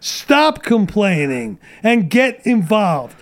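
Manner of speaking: usual speaking voice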